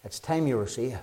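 hushed earnest appeal; soft urgent plea. Shown as a demonstration. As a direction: lean in and plead quietly. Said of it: "could I emphasize the urgency tonight".

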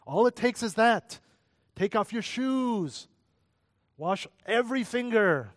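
high-pitched parental orders, each drawn to a point; fussing parent. Acted. Parent ordering young kids to clean up, high and insistent. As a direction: play a parent insisting on clean hands and shoes.

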